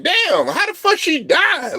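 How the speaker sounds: high, slow, stunned question; shocked disbelief. Retold or imitated. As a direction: drag it out in total shock. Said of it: "I just like".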